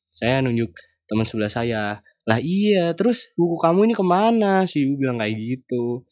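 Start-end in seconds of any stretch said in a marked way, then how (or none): 2.3-4.7 lightly imitated lecturer, pitched up and insistent; mock impatient questioning. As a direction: press the student for an answer, retold. Lecturer presses student about book.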